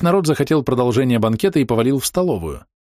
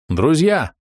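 raised, higher call for attention; loud announcement; 7 semitones higher than usual. A narrator's reading. Groom addresses the banquet tables, announcing loudly. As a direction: announce it to the room. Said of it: "объявил громко".

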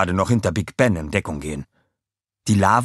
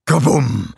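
explosion sound; 5 semitones higher, 6 dB louder than usual. Acted. Bomb explodes.

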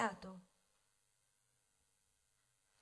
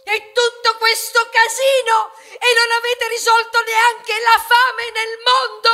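grido molto più forte e acuto, lento; urlo furioso; 9 semitones higher, 11 dB louder than usual. Acted. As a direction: urla tutta l'indignazione. Culmine dell'accusa. (grido acuto e furioso, rallentato)

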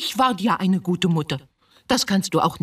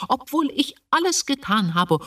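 reproachful outburst; 9 semitones higher than usual. Acted. Mother flares up at her son, high and bitter. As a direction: voice jumps high, pleading and bitter.

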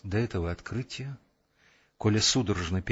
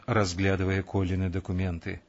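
ordinary delivery

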